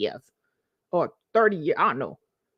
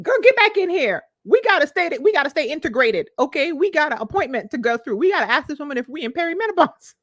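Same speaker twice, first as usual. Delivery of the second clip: higher, louder pep-talk to herself; inner self coaxing; 7 semitones higher, 4 dB louder than usual. Retold or imitated. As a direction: pull yourself back in, like a bossy friend. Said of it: "And healed me is trying to pull me back in".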